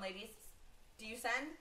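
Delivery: normal talking voice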